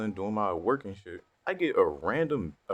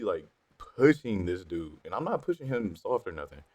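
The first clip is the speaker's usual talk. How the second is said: usual speaking voice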